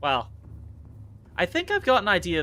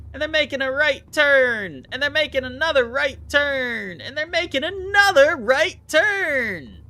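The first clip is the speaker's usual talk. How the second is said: mock announcer, higher and louder with each right turn, slowing at the end; mock race announcer, building; 10 semitones higher, 5 dB louder than usual. Streamer mocking NASCAR to viewers, hammed-up announcer building to a loud finish.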